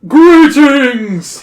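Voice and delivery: loud, high, drawn-out king's proclamation; booming. A narrator's reading. Giant cave king greets the visitors, booming. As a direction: boom it out across a great cavern. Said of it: "he booms".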